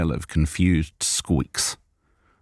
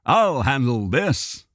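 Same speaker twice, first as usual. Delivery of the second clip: cheerful; 7 semitones higher, slower than usual. Acted. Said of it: "interjected cheerfully".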